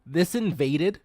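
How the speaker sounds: astonished, emphatic protest; loud disbelief. Real, not acted. Can't believe cute stoats are invasive.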